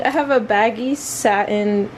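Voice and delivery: ordinary talk, nothing marked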